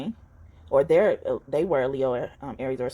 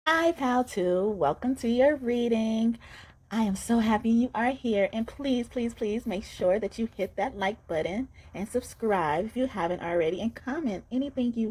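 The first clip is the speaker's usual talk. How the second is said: sweet welcome voice; 7 semitones higher than usual. Acted.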